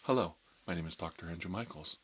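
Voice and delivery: usual speaking voice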